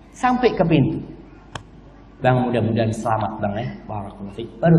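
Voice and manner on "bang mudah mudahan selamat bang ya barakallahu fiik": low, soft acted blessing; tender wifely farewell. Wife seeing husband off at the door, tenderly. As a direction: bless him gently, fading softer.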